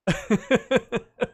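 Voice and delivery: laughing. Real, not acted. Laughs after Kermit attempt.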